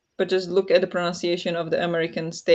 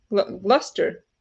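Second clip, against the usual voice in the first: ordinary delivery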